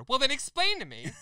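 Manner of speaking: high, loud angry yell; furious retold shout at customer service; screaming at the phone rep. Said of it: "Sorry for screaming".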